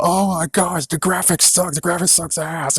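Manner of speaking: high whining mock complaint; mocking whiny fans. Mocking fans crying about the graphics, high and whiny. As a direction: whine like a spoiled fan. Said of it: "you guys are now crying about".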